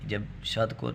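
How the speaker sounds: ordinary delivery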